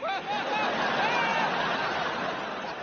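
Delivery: very high phony laughter; hammed-up nervous cover-up laugh; forced fake laugh. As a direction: laugh off the near tantrum for a child. Dad fakes cheer for toddler.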